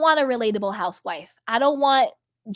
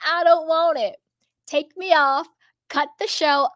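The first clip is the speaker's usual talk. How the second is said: higher, mock-tearful protest; imitating crying man; 5 semitones higher, 3 dB louder than usual. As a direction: do the meme man's wailing plea. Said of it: "the guy, he's like".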